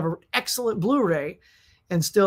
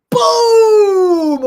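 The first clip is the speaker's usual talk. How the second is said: very loud, high, drawn-out boom; booming explosion; 14 semitones higher, 12 dB louder than usual. Retold or imitated. Storyteller imitates explosion outside the editing office, booming shout. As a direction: bellow the blast at full volume.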